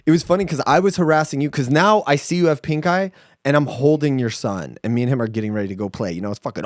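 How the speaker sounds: normal talking voice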